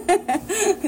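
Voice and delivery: a bright high burst of real laughter. Real, not acted. Host laughing at the child's burrito story, real laugh. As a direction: laugh freely at the joke.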